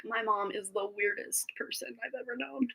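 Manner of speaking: whispered imitation of son. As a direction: hush it like a mock aside. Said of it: "He's probably like".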